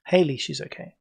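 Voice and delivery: ordinary delivery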